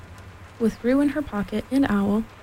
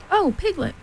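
high-pitched, excited greeting; 7 semitones higher than usual. A narrator's reading. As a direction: burst in with exciting news. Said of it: "said Pooh excitedly".

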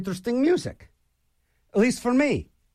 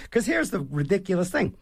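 ordinary talk, nothing marked

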